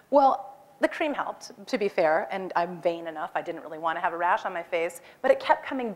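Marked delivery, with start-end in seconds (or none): none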